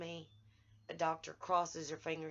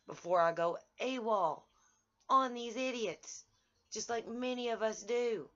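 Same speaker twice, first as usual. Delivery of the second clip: real anger; 6 semitones higher, 5 dB louder than usual. Real, not acted. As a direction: voice rising loud and high in anger. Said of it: "and now I'm just angry".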